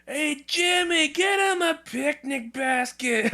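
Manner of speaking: very high, slow cartoonish voice; goofy impression. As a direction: stretch it out high and silly. Mock movie quote impression.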